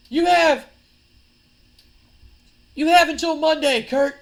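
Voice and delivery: high, loud wrestler's demand; angry ultimatum. Acted. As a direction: loudly lay down an ultimatum to a rival. Acted wrestler giving an ultimatum.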